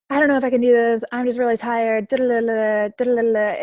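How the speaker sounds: high, loud, whining complaint; whiny overwhelmed coach. Retold or imitated. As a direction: mimic a tired coach moaning in your inbox. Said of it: "they're like".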